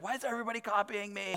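high, soft, plaintive whine; playfully aggrieved inner voice; mock complaint. Mock complaint about being copied.

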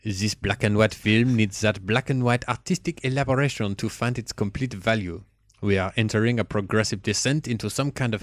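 reading a review in a put-on French accent. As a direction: read it like the Frenchman from last week. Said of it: "warm up the French accent again".

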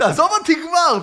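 high, loud repeated yell; retold shouting. Fleeing runner to a pestering umbrella vendor, shouting. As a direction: yell it to shake off a pursuer. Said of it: "ואני צועק עליו".

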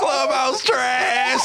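slow, drawn-out laughing yell; laughing shout. Real, not acted. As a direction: howl it out through laughter. Friends laughing at moaning story.

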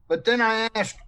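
ordinary delivery

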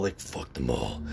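very low, slow, menacing demon growl; sinister demon from within; inner demon voice. Retold or imitated. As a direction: drop the voice deep and drag it like a demon inside. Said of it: "He's like".

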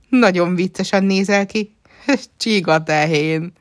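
high, loud teasing with a laugh; amused, laughing. A narrator's reading. Nana bursts out at Lia the snail-cow, laughing. És elnevette magát.

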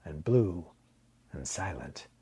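ordinary delivery

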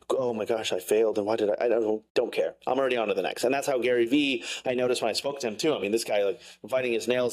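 usual speaking voice

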